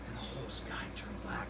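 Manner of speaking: hushed, low ominous whisper. Retold or imitated. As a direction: breathe it like a spooky secret. Retelling Jose's eerie sky remark.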